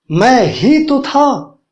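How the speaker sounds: slow, with ही pressed hard; emphatic stress. Shown as a demonstration. Teacher showing students an emphatic sentence, stress on ही. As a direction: say मैं ही तो था slowly, weighting ही. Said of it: "means जोर दिया जा रहा है इस पे".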